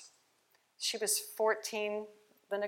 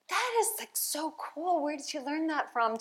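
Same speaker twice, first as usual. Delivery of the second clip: amazed reaction; 8 semitones higher than usual. Retold or imitated. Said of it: "I'm like".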